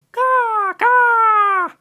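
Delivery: shrill high caw-caw, squawked like a crow; bird squawk. Acted. Bird-headed god squawking over the vegetable patch, loud crow caws. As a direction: caw twice at full throat like a huge bird.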